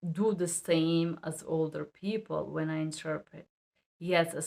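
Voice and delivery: usual speaking voice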